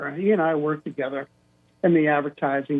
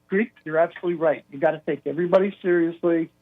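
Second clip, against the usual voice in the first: normal talking voice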